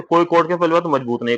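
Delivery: ordinary delivery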